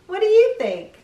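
light up and ask them; excited question. Real, not acted. Asking the watching children, high and excited.

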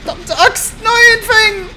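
high, loud shout of no; dismayed yell. Real, not acted. Player yelling as teammate Feng dies. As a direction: cry out at the loss.

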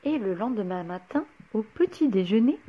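plain reading voice

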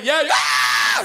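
high mock yell from the dark; sudden hammed-up scream back; intruder's answering yell. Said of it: "and somebody went".

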